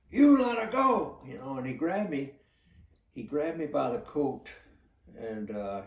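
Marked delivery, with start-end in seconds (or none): 0.1-1.2 retold furious scream, much higher and louder. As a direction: hurl the accusation in rage. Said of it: "he's still screaming at me".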